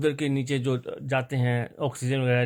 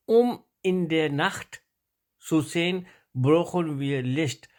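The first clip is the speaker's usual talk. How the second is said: reading practice sentence; 3 semitones higher, slower than usual. Shown as a demonstration. Teacher reads before translating, slow and deliberate. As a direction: read it out deliberately, in separated chunks. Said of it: "وہ میں آپ کو پڑھ دیتا ہوں".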